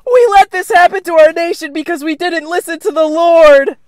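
high, loud preacher's cry; fire-and-brimstone preacher. Acted. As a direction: thunder like a street preacher. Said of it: "my evil counterpart with my opposite views".

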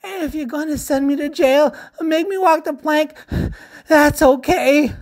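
high, loud tearful wailing; crying. A narrator's reading. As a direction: sob out a desperate confession. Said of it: "Splat cried".

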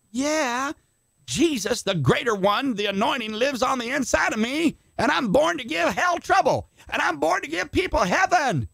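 high, ringing preacher proclamation; full-force preaching. Acted. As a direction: preach it out with rising fire.

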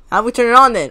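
ordinary talk, nothing marked

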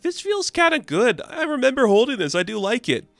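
high, lofty Loki voice; hammy godly boast, pitched high. Acted. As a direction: Loki delighting in the hammer's power. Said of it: "and they're like".

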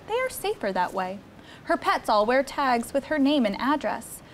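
lively picture-book read-aloud; reading to children. Acted. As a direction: read it like a storybook for a small child.

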